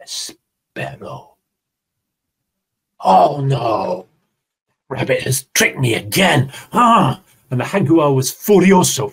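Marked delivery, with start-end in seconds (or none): 0.0-1.4 slow whisper. Acted. Storyteller to class, whispering the long wait. 3.0-7.2 angry jaguar shout. Acted. Jaguar realises the trick.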